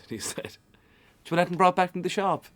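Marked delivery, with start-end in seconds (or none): 1.2-2.4 high, loud, quick shout; raised-voice cheeky question from the crowd; calling out. Retold or imitated. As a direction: call it over to someone behind you, fast. Acting out fan calling to keeper.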